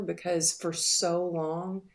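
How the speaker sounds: ordinary talk, nothing marked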